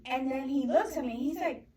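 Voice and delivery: ordinary talk, nothing marked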